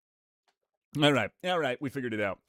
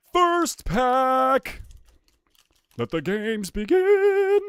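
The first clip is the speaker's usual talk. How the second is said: loud, high, drawn-out proclamation; mock announcer shout; 11 semitones higher, 6 dB louder, much slower than usual. Streamer announcing the first pack to chat, high announcer shout. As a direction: call it out like a game opener.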